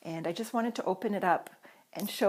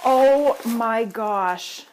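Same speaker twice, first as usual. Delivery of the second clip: awed gasp; 3 semitones higher, 9 dB louder, much slower than usual. Real, not acted. Vlogger finding glitter in the gift package, slow and awed. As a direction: let it stretch out in delighted amazement.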